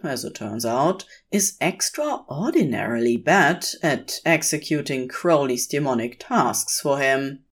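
ordinary delivery

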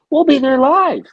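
high, loud showy proclamation; excited hype cry. Pretend. Host hyping their live attendance, high excited cry.

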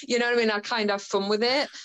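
ordinary talk

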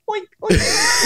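very high mock pig squeal; playful high-pitched oinking; pig oinks. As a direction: squeal oink like a piglet. Imitates the live pig.

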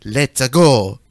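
loud, low cartoon-Italian catchphrase; Italian accent. Shown as a demonstration. Critic demonstrating Mario's catchphrase, mock Italian accent. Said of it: "do it in an Italian accent".

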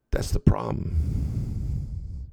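a hint of a wise old dog, very slow; calm old dog. Old German Shepherd to the young Doberman, slow and calm. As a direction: let it land slowly, unbothered.

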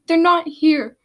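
loud, high protest; shouted. A narrator's reading. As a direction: shout it in frustration. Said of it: "Seashell shouted".

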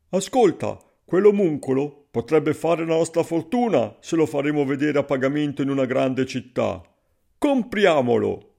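loud, keen put-on schemer's voice; scheming stranger. A narrator's reading. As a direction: pitch a money-making plan to a companion. Stranger plots to buy him. (forestiero avido, voce forte)

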